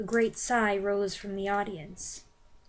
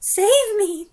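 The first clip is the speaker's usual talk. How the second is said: high, loud desperate cry; crying witch; 11 semitones higher, 10 dB louder than usual. Acted. Front-row witch crying for help. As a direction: cry out for rescue. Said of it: "cried the wretched witch".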